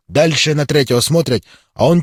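ordinary delivery

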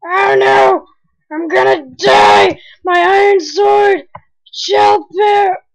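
high, loud panicked shouting; panicked yell. Real, not acted. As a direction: scream out in real panic. Gamer dies, yells in panic.

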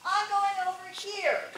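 high, loud, stubborn refusal. Retold or imitated. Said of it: "being stubborn is not".

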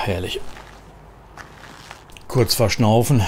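usual speaking voice

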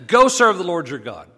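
loud, forceful royal order; lightly voiced Pharaoh. Pharaoh yielding to his servants, a loud order. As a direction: give a grudging order loudly. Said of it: "Pharaoh says".

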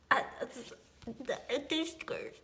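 ordinary talk

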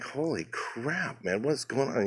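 very soft, very slow, alarmed; hushed alarm. Retold or imitated. Spotting the driver's gun, hushed slow alarm. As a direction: breathe it out, scared of being heard.

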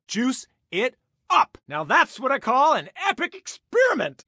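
high, loud gleeful cry of triumph; excited shout. Acted. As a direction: crow over the experiment, loud and high. Gleeful lab experiment.

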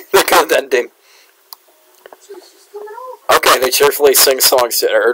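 usual speaking voice

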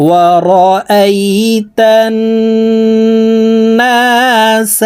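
الناس chanted with a long nasal hum on the n; ghunnah. Shown as a demonstration. Teacher models the nasal hum in الناس, slow chant. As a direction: hold the doubled n of الناس through the nose. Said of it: "তান এখানে ওয়াজিব গুন্নাহ".

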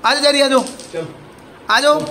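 high coaxing call to a dog. Real, not acted. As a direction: call the puppy over sweetly. Calling the dog to come. (कुत्ते को ऊँची आवाज़ में पुकारना)

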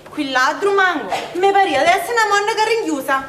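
ordinary talk, nothing marked

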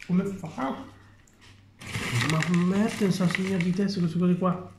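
high mocking fan voice. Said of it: "voi che avete la mente vuota".

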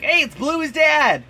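put-on high, loud, drawn-out excited kid shout; imitating thrilled kids. Dad imitating his kids spotting Bluey's dad, thrilled. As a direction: mimic kids yelling in delight. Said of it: "They are all thrilled when they're like".

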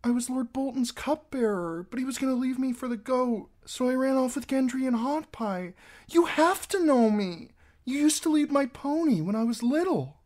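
put-on high girl voice, urgent and tearful; pleading girl. A narrator's reading. Girl explaining herself to Harwin, high tearful pleading. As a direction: rush the story out, pleading.

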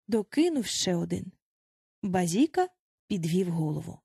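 ordinary delivery

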